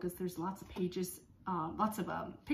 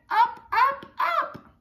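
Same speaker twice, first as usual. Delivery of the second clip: climbing excited ups; 11 dB louder, slower than usual. Acted. Rising excitement in story.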